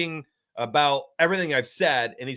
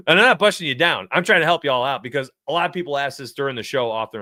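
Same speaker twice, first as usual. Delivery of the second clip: ordinary talk, nothing marked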